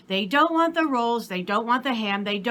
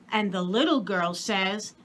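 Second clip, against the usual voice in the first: ordinary delivery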